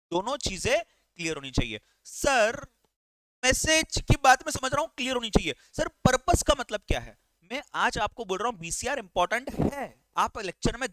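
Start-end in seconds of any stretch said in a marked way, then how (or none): 2.0-7.2 puzzled student asking. Pretend. Teacher voices a student's question.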